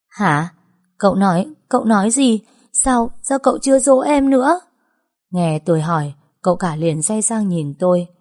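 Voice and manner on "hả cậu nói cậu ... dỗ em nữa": higher, coy, teasing; playful feigned deafness. Acted. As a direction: pretend not to hear, pushing him. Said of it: "Tôi vờ như là không nghe rõ".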